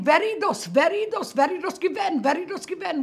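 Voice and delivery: high, loud, excited overlapping-style repetition; animated women. Retold or imitated. Narrator imitating women running out of the apartment, animated.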